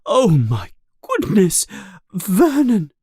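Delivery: high, flustered cries; shocked gasp. A narrator's reading. Petunia crying out to her husband in high shock. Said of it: "She coughs and says".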